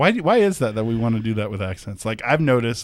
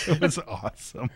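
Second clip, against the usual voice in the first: ordinary talk, nothing marked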